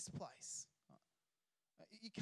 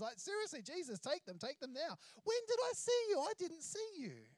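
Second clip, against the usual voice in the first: protesting crowd member; 3 semitones higher, 4 dB louder than usual. Retold or imitated. Preacher voicing startled listeners protesting to Jesus, rising indignation. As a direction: protest your innocence, rising in disbelief. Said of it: "imagine the people there ... going".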